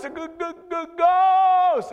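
high stutter bursting into a loud ghost; mock terror. Preacher mocking the frightened men of God, hammed-up terror. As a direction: stammer on the g, then wail GHOST. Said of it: "fear makes you irrational".

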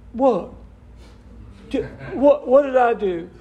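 high, laughing mock innocence; mock innocent. Pastor mimicking the angry person, laughing innocently. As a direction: play the clueless offender, laughing.

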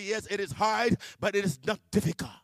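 a loud, firm God, lightly voiced; God insisting. God answering in the bathroom, insisting. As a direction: push back hard and reassure.

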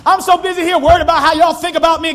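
high, loud pulpit shout; shouting preacher, pressing hard; preaching at full force. Acted. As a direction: call the church out with fire.

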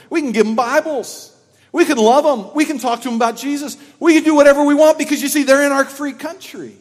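loud, fast, rising preacher voice; fiery preaching. Acted. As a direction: preach it with full fire. Sermon builds to full force.

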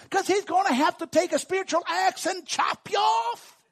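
high, impassioned pulpit delivery; full-force preaching. Real, not acted. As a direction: build to a loud, high shouted finish.

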